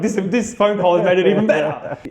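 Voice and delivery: high, loud, gushing delight; excited gush. Retold or imitated. Acting out the thrilled reply on the phone, high and loud. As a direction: burst out thrilled to the phone caller. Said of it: "I was like".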